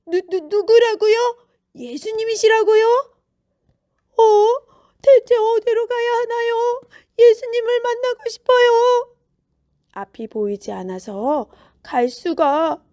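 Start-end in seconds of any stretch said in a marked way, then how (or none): 0.1-9.1 high, loud, stammering astonishment; astonished questions turning to urgent yearning; startled beggar. A narrator's reading. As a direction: react in surprise, then wail with longing. Blind man startled by the news.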